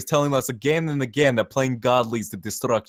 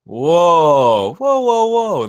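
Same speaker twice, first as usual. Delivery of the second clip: 1 calling out; 5 semitones higher, 6 dB louder, much slower than usual. Acted. Jeremy bursts into the uproar.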